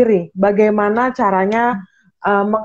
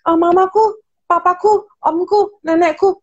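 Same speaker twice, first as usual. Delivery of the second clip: high whiny listing, pitched up; mock complaining people; 7 semitones higher than usual. Mocking how people list relatives they blame, high whiny. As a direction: play the people who blame their family. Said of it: "orang-orang tadi nggak bilang".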